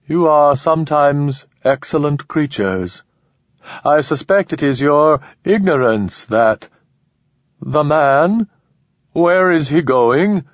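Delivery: slow, high alien command; cold rising alien urgency. Acted.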